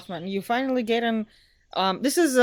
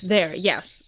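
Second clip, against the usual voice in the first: ordinary delivery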